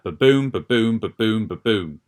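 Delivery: "ba-boom" repeated as an even, bouncing beat; rhythmic meter demo. Shown as a demonstration. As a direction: beat out "ba-boom, ba-boom" in regular pairs of syllables. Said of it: "it's supposed to be kind of like".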